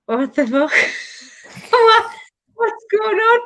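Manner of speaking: high, laughing shriek of disbelief; shocked disbelief. Retold or imitated. Seeing her best friend walk in with the baby, shrieking in laughing shock. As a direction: squeal like you can't believe your eyes. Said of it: "I nearly passed out".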